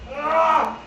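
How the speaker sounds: high frantic yelling throughout; frantic screaming. Acted. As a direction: shriek while fighting for life. Fighting the monster in toilet.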